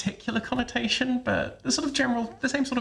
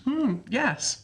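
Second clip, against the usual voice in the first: lifted "Mm, yes"; agreeing sound; 4 semitones higher than usual. Shown as a demonstration. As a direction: raise the pitch on "Mm, yes" to agree. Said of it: "can you make an agreeing sound like yes".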